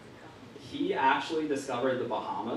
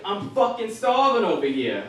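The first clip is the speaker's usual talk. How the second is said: B-movie Italian dad; 5 semitones higher, 5 dB louder than usual. Retold or imitated. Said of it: "He's like".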